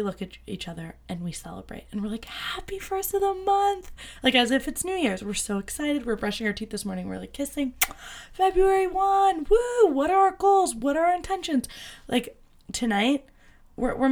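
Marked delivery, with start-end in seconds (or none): 2.3-3.9 high, slow, breathy-sounding celebratory hush; sing-song it like New Year's morning; excited greeting. Acted. Greeting her fiance on the first of the month, high and giddy. 8.3-11.7 high, loud cheering; excited celebration. Retold or imitated. Cheering the new month with her fiance, high and loud.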